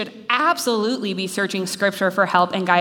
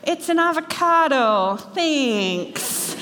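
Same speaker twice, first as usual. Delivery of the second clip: put-on cute kid's higher start, dropping on thanks; lightly voiced child at Christmas; imitated little kid; 5 semitones higher, much slower than usual. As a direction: imitate a little boy politely receiving an odd gift. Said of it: "the way he says it".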